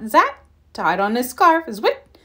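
plain reading voice

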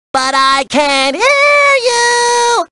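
high, drawn-out cartoon call; goading singsong. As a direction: stretch the last words high to coax a shy singer. Goading a shy player to sing.

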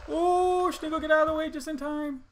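loud high fast relief; excited relief. Real, not acted. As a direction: blurt it out in relief. Near miss in game.

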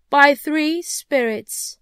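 very slow, loud, high intoning; ominous ghost. Acted. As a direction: drag out the prophecy like a spectre. Ghost warns Scrooge.

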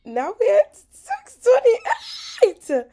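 high, loud and drawn out; excited. Real, not acted. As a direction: cheer the number, stretched slow. Celebrating a new count.

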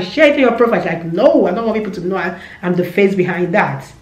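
usual speaking voice

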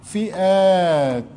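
فئات drawn out slowly; slow dictation. Shown as a demonstration. Teacher dictating to pupils, slow drawn-out فئات. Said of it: "teacher dictating slowly".

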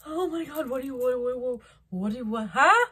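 mock stunned babble; flustered mock shock. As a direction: splutter in overwhelmed disbelief. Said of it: "You're gonna be like".

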